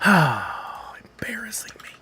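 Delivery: slow breathy mock whisper. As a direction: hiss it under your breath. Scolding pack after sigh.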